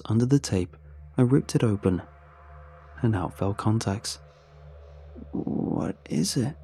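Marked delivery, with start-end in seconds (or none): 5.3-6.5 slow, high, drawn-out question; curious question. A narrator's reading. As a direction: ask slowly in a small voice. Anne asks about the box.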